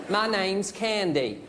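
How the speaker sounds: very slow American drawl; American woman. Acted. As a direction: play the American woman introducing herself. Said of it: "This American woman".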